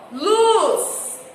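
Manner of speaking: luz called out high and loud; stressed luz. Shown as a demonstration. Teacher modeling the stressed word luz for pupils, high and loud. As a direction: hit luz with full intensity. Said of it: "nós falamos ela com mais intensidade".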